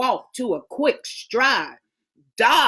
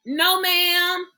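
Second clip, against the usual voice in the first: plain reading voice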